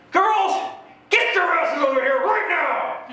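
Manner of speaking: high, slow hollered summons; mock parent yell. Dad calling the girls down from the slide, a high yell. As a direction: holler for the girls across the park.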